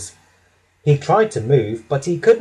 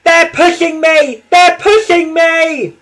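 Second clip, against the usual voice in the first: high, loud frightened cry, slow and strained; panicked panting; 14 semitones higher, 8 dB louder than usual. A narrator's reading. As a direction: gasp it out, terrified and breathless. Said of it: "he panted".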